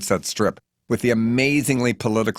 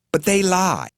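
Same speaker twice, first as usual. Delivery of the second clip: higher, indignant matron in a housecoat; Missus Adderley; 9 semitones higher than usual. Acted. Missus Adderley griping to Bernice about the library. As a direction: fuss and complain, pitched up.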